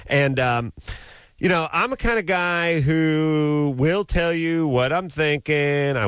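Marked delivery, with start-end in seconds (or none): 1.4-5.9 blowhard voice. Retold or imitated. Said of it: "I like this blowhard".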